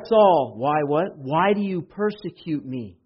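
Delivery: high and loud preacher voice; impassioned preaching. Acted. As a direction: keep the fire going. Preaching continues raised.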